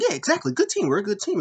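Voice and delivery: hammed-up high agreeing voice; imitating Lana. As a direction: squeak it out eagerly. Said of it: "Lana was like".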